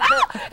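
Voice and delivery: sudden very high yelp; mock gunshot yelp. Actress acting out a gunshot, sharp mock yelp. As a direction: yelp like a shot just rang out. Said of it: "you get a gunshot".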